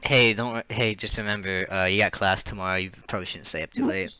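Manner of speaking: mild reassuring teacher. Retold or imitated. Lenient teacher finding student in the woods, gentle. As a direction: soothe them like a lenient teacher. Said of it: "they'd be like".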